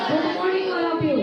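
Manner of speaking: slow, clear teacher demo; slow model sentence. Shown as a demonstration. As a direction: spell it out slowly for the class. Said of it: "क्या बोलेंगे?". (धीरे-धीरे साफ़ बोलकर सिखाना)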